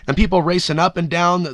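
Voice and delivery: normal talking voice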